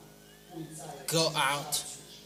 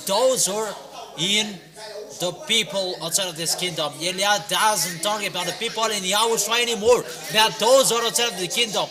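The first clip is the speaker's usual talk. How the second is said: loud, pounding sermon delivery with higher pitch; fiery preaching; 4 semitones higher, 6 dB louder than usual. Real, not acted. Preacher condemns the unbelievers in a loud, driving sermon. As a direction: preach it hard to the crowd, almost shouting. Preacher exhorting crowd loudly.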